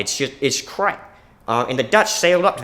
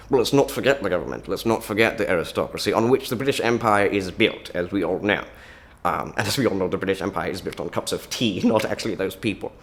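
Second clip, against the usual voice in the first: measured, lower-pitched southern English accent; 5 semitones lower than usual. Shown as a demonstration. Englishman defending aristocracy and Empire, southern English accent.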